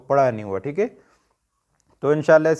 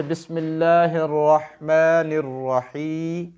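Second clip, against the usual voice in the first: slow, melodic Quran recitation; recited Arabic; much slower than usual. Shown as a demonstration. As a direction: recite it slowly with tajweed. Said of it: "असल में इसकी writing क्या है".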